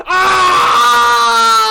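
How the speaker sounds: loud high cry of defeat; a sharp loud wail when the rival wins; loud anguished cry. Real, not acted. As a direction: yell out in despair as the vote is lost. His side loses the vote.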